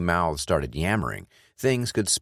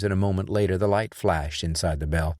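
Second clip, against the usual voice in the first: plain reading voice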